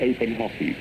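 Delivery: ordinary talk, nothing marked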